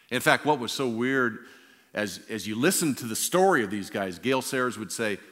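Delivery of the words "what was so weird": ordinary talk, nothing marked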